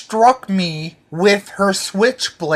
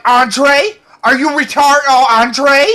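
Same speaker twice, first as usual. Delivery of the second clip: high, loud hammed-up scolding officer; shrill-pitched mock cop rant; mock officer yelling; 6 semitones higher, 4 dB louder than usual. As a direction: yell at him like an exasperated cop. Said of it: "she's like".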